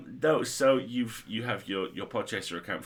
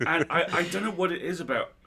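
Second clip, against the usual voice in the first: ordinary talk, nothing marked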